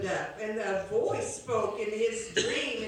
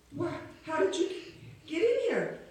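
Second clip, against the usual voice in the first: startled; 6 semitones higher than usual. Acted. [name] startled by woman appearing.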